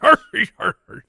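high, loud pirate "arr" repeated; pirate cries. Pretend. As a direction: throw out playful pirate arrs. Pirate noises after each option.

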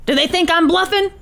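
mildly agitated challenge; Tony. Acted. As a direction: demand an answer as a hostage-taker.

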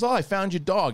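high, falsely friendly mobster voice; hint at the threat with fake cheer; sly mobster. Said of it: "takes his dog and goes".

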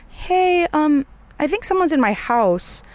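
high, hesitant little voice; tentative plea, pitched well up; retold nervous caller. Frightened caller telling the operator of an intruder, mildly nervous. Retold scared call to emergency line.

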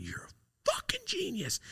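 breathless, high whisper of amazement; awed whisper. Retold or imitated. Teen whispering to his uncle in awe, whispered. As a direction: whisper it like he just saved your life.

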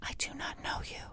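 hushed, breathy whisper of denial. Retold or imitated. Host imitating Amy denying she knows Bill, in a whisper. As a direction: whisper it flat and scared. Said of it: "he's talking to her in a normal voice, and she's saying".

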